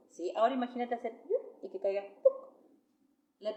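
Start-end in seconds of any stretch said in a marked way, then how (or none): 1.3-1.6 quick vocal slingshot noise; little demonstrative "yup" effect; imitated slingshot sound. Shown as a demonstration. 2.2-2.3 short popped "puc" of a falling stone; abrupt vocal plop; imitated thud. Shown as a demonstration.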